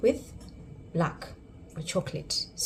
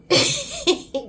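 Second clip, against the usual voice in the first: high burst of laughter; 5 dB louder than usual. Real, not acted. Laughing at chocolate Brits joining.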